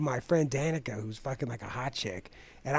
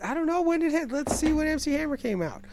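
much higher, defensive protest; 11 semitones higher than usual. Real, not acted. Arguing with his friend about [name], high defensive protest. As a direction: pitch it up, protesting.

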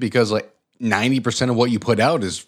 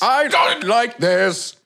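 slow, higher, stretched "I don't like this"; drawn-out verdict; 6 semitones higher, much slower than usual. Shown as a demonstration. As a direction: drag out "I don't like this" as the set answer. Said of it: "it needs to be like this".

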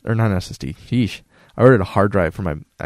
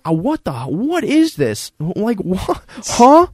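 confused; 10 semitones higher, much slower than usual. Retold or imitated. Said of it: "I'm like".

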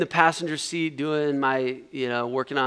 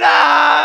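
high, full-voiced scream; loud scream; 11 semitones higher, 13 dB louder than usual. Friend screaming as the car careens, loud scream. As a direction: yell out in mock terror. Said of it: "he's, like, screaming".

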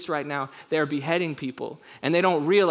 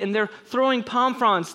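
usual speaking voice

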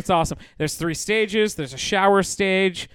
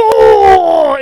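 loud, high, drawn-out "oh"; hammed-up loud wail; mock moan; 12 semitones higher, 13 dB louder than usual. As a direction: howl like the startled trucker. Imitates trucker with mouthful.

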